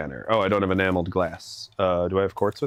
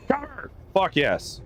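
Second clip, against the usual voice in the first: excited; 9 semitones higher than usual. Real, not acted. Finding copper at last.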